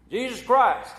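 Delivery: high, loud blurted Jesus Christ; startled exclamation. Retold or imitated. Bar patron exclaims at the frog, startled and loud.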